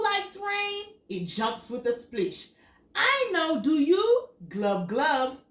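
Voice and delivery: lively storybook read, big high questions; animated picture book reading; reading to children. Acted. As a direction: read it out to little kids with wonder. Reads picture book aloud.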